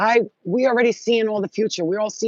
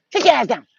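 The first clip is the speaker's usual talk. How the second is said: stern order; 3 semitones higher, 5 dB louder than usual. Real, not acted. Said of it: "scolding the cat".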